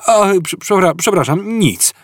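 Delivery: high, stumbling apology; embarrassed stammer. A narrator's reading. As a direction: trip over the word in fluster. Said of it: "tłumaczył się Artur zakłopotany".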